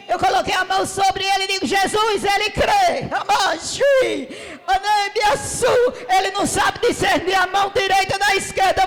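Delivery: loud, high, urgent prayer; fervent shouted prayer. Retold or imitated. Grandmother loudly prays over her sick grandson, fervent and pleading. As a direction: cry out to Jesus for healing.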